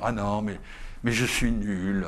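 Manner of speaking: mock self-pity. Mimics a self-despising believer.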